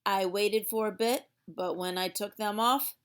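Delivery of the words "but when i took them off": ordinary delivery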